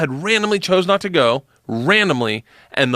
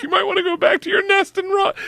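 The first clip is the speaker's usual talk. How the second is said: much higher voice, laughing; mock shrill jab. Friend mocks comic's heckle line, high laughing squeal. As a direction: squeal the insult between laughs.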